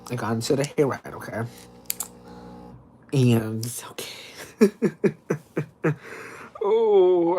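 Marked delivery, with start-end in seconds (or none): 4.8-6.6 real giggling fit; helpless run of giggles; laughing. Real, not acted. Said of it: "I'm just laughing because".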